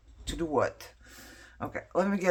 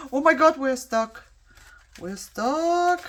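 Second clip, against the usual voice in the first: high, loud startled cry; sudden panic as the die jams; alarmed surprise; 10 semitones higher, 9 dB louder, slower than usual. Real, not acted. Crafter startled when the die gets stuck, high and loud.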